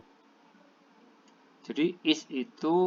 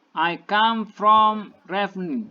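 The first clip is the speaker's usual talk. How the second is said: each word slow and clearly spaced, as for learners; slow model reading; 5 semitones higher, 4 dB louder, much slower than usual. Shown as a demonstration. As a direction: read it slowly like a teacher dictating. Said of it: "And then the second sentence".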